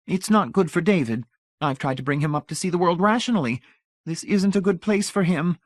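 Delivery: high, prim matron voice; worried society mother; fussy mother. A narrator's reading. Mrs Pritchett voiced.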